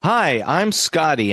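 ordinary delivery

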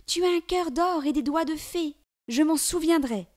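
high, light cat voice; a grateful little cat, voiced up high; talking cat. A narrator's reading. Cat thanking the girl, high creature voice.

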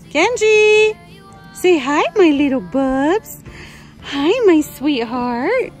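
high, loud, drawn-out sweet call; doting pet talk. Real, not acted. Calling and cooing to Genji.

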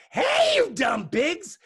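high, drawn-out holler at the pigs; yelled. A narrator's reading. Megan yells at the pigs in the pen, high drawn-out yell. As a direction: yell it loud and high, stretching the words. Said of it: "Then Megan yelled".

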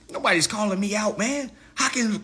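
normal talking voice

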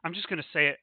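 ordinary talk, nothing marked

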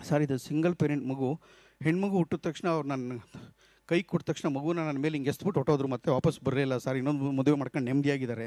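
low and soft, a mother's quiet grief; grieving mother. Retold or imitated. Mother telling the speaker her husband left, low and sorrowful. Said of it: "ಅವ್ಳು ಹೇಳ್ತಾಳೆ".

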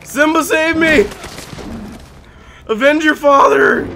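high, loud put-on voice of a dying king; mock dying king. Gamer voicing his dying bear as Mufasa, high mock plea. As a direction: ham up the dying father's last words.

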